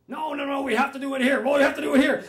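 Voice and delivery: loud, high, insistent refusal; really angry. Retold or imitated. Visitor angrily insisting to the caretaker on the seance. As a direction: dig in and demand it, voice rising. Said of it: "really angry now".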